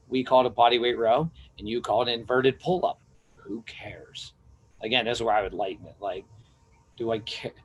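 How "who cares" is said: whispered aside. Real, not acted. Dismissive aside mid-rant.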